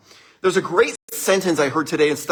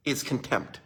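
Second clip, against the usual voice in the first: normal talking voice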